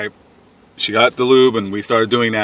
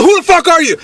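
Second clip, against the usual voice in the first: high, loud confrontational yell; angry challenge; 5 dB louder than usual. Retold or imitated. Gunman to stranger in his yard, angry yell. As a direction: shout it as an armed stranger's challenge. Man with gun confronts him.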